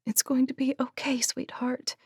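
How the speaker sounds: hushed, tender whisper; soothing whisper. A narrator's reading. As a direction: promise comfort in a whisper. Soothing dying horse.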